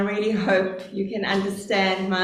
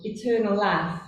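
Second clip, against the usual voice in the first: usual speaking voice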